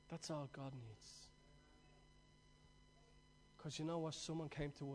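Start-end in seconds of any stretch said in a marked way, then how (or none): 0.1-1.3 quiet echo. Real, not acted. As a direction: repeat "That's all God needs" much softer, almost to yourself. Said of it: "But that's all God needs".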